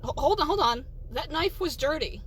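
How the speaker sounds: indignant objection. Acted. Customer accuses sandwich maker of a dirty knife, indignant.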